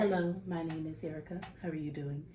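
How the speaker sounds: soft, careful professional greeting; code-switched interview voice. Acted. Job candidate greeting an interviewer, code-switched professional voice. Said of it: "I've been in an interview".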